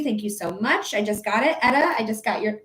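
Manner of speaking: usual speaking voice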